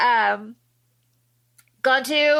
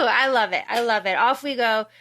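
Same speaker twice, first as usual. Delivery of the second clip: ordinary talk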